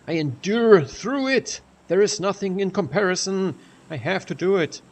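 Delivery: steel yourself out loud; dramatic resolve. Retold or imitated. Retelling own determined thought.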